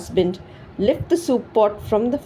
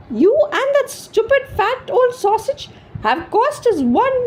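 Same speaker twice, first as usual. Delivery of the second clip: furious screech; 12 semitones higher than usual. A narrator's reading. Wife screeching at her husband over the sausage. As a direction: tear into him, outraged. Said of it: "she screeched".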